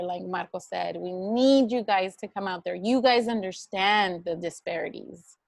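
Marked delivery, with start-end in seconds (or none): none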